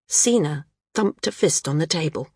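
ordinary delivery, nothing marked

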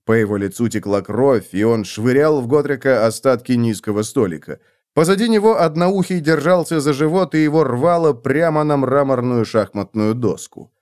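ordinary delivery

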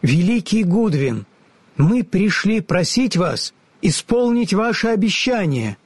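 put-on high, earnest young girl; girl's voice. A narrator's reading. Ellie asks the wizard to keep his promises, earnest. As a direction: ask politely like a brave little girl.

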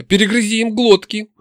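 high, fierce command; exclaiming demon. A narrator's reading. Nastya egging the fleeing hero on, high exclamation. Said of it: "воскликнула у меня в голове Настя".

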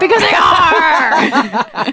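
slow laughing shout. Real, not acted. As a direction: bursts out laughing as she shouts. Repeating the joke answer.

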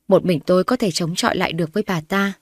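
ordinary delivery

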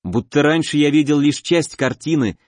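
ordinary delivery, nothing marked

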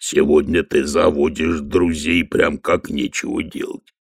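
low, slow troll rumble; lightly voiced lumbering troll. Troll Detritus speaks.

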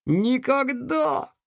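«Никогда!» cried high and slow; defiant cry. Acted. Повторный вызов Алекса. («Никогда!» громко и с вызовом)

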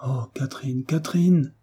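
acted grief, hushed then swelling upward; anguished lament. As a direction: call out the beloved's name in heartbreak. Despairing lover calling out her name.

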